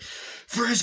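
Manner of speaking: high-pitched mock yell; mock police shout. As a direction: burst in with a high imitated command. Acts police bursting in.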